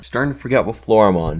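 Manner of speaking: ordinary talk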